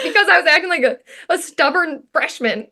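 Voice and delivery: high-pitched talking through laughter; laughing. Real, not acted. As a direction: laugh your way through the quote. Laughing while retelling a joke.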